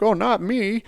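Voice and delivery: high, breezy brush-off; mock naive denial. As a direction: toss it off as a cocky new guy who thinks it won't happen to him. New guy brushing off veterans' warning.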